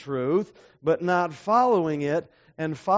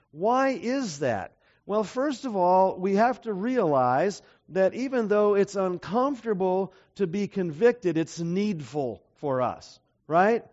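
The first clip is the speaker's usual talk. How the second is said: normal talking voice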